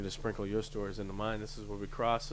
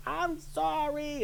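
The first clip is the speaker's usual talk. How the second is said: meek apology; much slower than usual. Retold or imitated.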